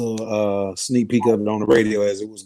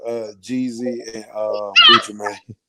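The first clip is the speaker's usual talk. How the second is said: wearing teeth grill. Real, not acted. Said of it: "I got my grill in my mouth".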